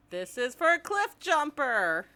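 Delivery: high, forceful avenger's cry. Retold or imitated.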